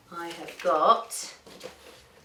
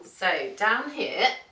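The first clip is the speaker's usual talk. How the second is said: ordinary delivery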